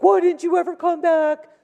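mock scolding doctor; unkind surgeon. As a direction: bark the question like an unkind surgeon.

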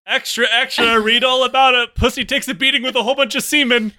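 high, loud newsie cry; newsboy shout. Pretend. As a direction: bellow it like a paperboy on the corner. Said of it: "A newsie".

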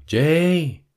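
high, drawn-out mock call. Mother calling her child from another room, imitated call.

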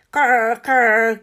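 loud rasping caw, like a crow; hoarse croak of a crow. A narrator's reading. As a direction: caw like a crow clearing its throat. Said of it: "mint egy varjú".

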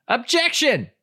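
very high, playful shout to the judge; mock courtroom objection. Player interrupts the mock court, hammed-up objection. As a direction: cry out a hammed-up legal objection.

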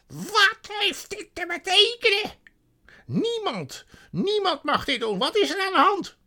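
shrill high furious shriek; enraged sorceress. A narrator's reading. Witch to the feasting animals, enraged shrieking. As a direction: screech in loud outrage at them.

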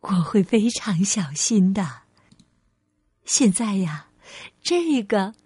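low, hushed tender voice; smiling mother. Acted. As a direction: promise gently, touched by a gift. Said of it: "笑着说".

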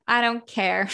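ordinary talk

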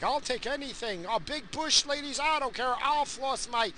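high mock eager voice of a desperate guy; lightly hammed-up desperate man. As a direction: play a guy who'll take anything. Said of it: "they're just like".

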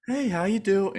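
bright, high friendly greeting; chirpy polite hello; customer service voice. Shown as a demonstration. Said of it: "my nice voice".

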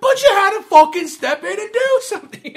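high, loud mock outrage; exasperated rant. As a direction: shout it as an imitated fed-up complaint. Acted rant from the film.

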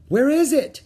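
high, loud alarmed question; frantic high yell; shouting. A narrator's reading. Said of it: "shouted Annie".

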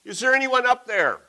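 high, loud desperate cry for help; shouted for help. A narrator's reading. Man dangling from a branch, shouting up for help. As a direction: yell up the cliff in panic. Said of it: "and he shouted".

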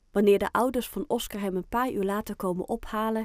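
plain reading voice

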